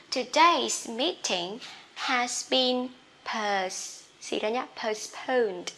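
slow model sentence. Shown as a demonstration. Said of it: "teacher models sentence slowly".

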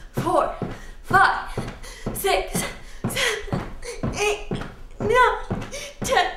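jumping jacks count, breathless and rising; straining count. Acted. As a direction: count through the burn.

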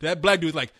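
usual speaking voice

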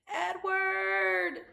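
high, loud, drawn-out call; long distant shout. A narrator's reading. Abilene calls to the falling rabbit, shouting. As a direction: call the name out across the water. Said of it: "she shouted".

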